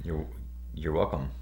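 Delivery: low, soft, halting reply; stuttered. Real, not acted. Husband distracted answering his wife's thanks, stuttering. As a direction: stumble on the first word and restart. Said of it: "Why did you stutter on".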